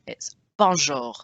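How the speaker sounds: normal talking voice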